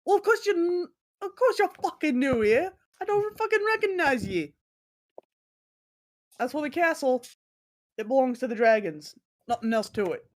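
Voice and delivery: high-pitched, cursing tavern keeper in a put-on accent; irritable bartender. Bartender snapping at a newcomer about the castle, irritable bartender role. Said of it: "guy just looks at you and is like".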